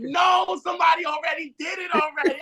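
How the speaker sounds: high excited mock shout; retold shout. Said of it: "I was like".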